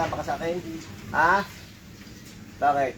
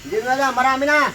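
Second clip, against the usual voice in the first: forceful interrogation; 5 semitones higher than usual. Real, not acted. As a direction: hammer the questions out, raised and insistent. Healer interrogates spirit about its victims.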